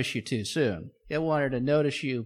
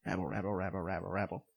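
crowd murmur; 7 semitones lower, 10 dB quieter, faster than usual. Acted.

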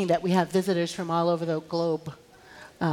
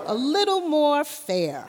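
leading prayer; 6 semitones higher, slower than usual. Real, not acted. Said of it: "Repeat after me".

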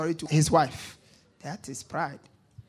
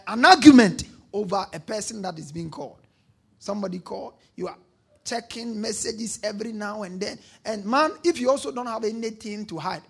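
ordinary delivery

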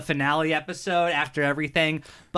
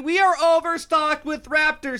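hard sell ad; 6 semitones higher than usual. Pretend. Parody sale ad pitch.

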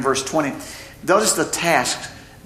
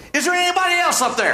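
punchline; 12 semitones higher than usual. Retold or imitated. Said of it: "you hear the guy say".

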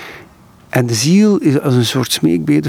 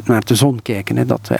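normal talking voice

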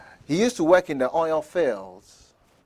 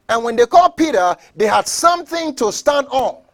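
loud, raised pulpit delivery; full-force preaching; 7 semitones higher, 7 dB louder than usual. Real, not acted. Preacher telling how they called the pastor, forceful preaching. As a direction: drive it home to the congregation.

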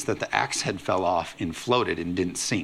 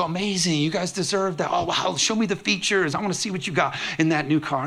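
higher, eager congratulations; excited for them; 7 semitones higher than usual. Pretend. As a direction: gush over the new car. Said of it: "No, you go".

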